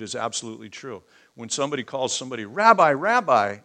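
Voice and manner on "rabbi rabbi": "Rabbi, Rabbi" louder and drawn out, the second "Rabbi" echoing the first; emphatic. Shown as a demonstration. Calling a teacher "Rabbi, Rabbi" to honor him emphatically. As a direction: hail him twice, loud and slow. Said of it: "it's emphatic".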